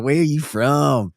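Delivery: hammed-up Southern twang; mock Southern accent. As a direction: imitate a scrunched-faced Southerner, drawing it out. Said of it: "His face is all scrunched up".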